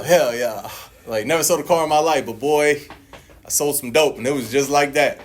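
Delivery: usual speaking voice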